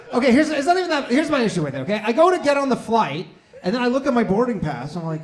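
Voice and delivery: usual speaking voice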